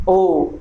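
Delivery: ओ as a half-close back vowel with rounded lips; vowel demo. Shown as a demonstration. Teacher showing students the ओ vowel, rounded. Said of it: "यहाँ भी mouth half close है".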